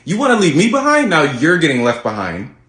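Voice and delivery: put-on Valley Girl accent. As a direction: lecture him with high sass. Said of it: "this Valley Girl accent".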